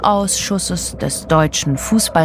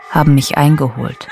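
ordinary delivery

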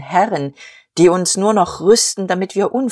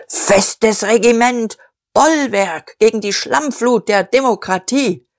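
bombastic ranting; 5 semitones higher than usual. A narrator's reading. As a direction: rattle the slogans out. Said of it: "Kraftworte stießen rasselnd aneinander". (pathetisch gedonnert)

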